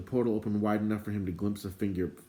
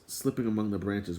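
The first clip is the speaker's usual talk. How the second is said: ordinary delivery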